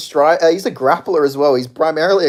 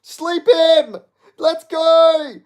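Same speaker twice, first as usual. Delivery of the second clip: high, loud cheering yell; excited shouting; 12 semitones higher, 6 dB louder, much slower than usual. Real, not acted. Fan yelling at the screen during a knockout, excited shout. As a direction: scream for your fighter to finish it.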